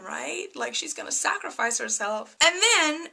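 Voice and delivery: ordinary talk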